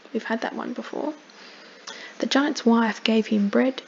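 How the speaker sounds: plain reading voice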